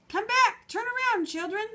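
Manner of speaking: put-on high, loud call to the children; shouting after children. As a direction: call them back from afar. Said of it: "they shouted".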